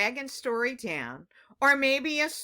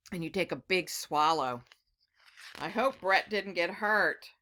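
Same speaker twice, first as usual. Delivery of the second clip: ordinary delivery, nothing marked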